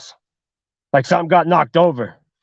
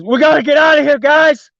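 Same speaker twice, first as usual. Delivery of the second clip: high, raised, urgent cry; panicked yell; 8 semitones higher, 4 dB louder than usual. Acted. Man warning his friends after seeing the woman, panicked yell. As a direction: yell to the others to flee.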